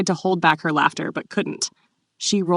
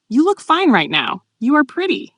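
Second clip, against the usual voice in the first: higher, earnest insistence; serious; 8 semitones higher, 4 dB louder than usual. A narrator's reading. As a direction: state it with sincere emphasis. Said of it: "he said seriously".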